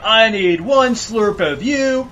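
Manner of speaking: slow, high, loud slurping vampire; greedy vampire. Pretend. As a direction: drag it out like a gluttonous vampire. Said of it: "he's like".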